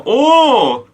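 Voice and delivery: high, loud, stretched-out celebration; excited cheer. Real, not acted. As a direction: whoop with joy and thank the donor. Celebrating another donation live.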